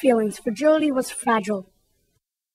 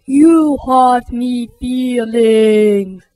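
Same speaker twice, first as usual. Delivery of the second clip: very slow, louder hurt bully; wailing; 6 dB louder, much slower than usual. Acted. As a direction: blubber it out like a big baby. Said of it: "and wailed".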